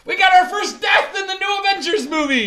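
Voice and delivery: high, loud mock-celebratory shout; retold gleeful excitement. Retelling their reaction to a first death, gleeful shout. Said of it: "I had a smile".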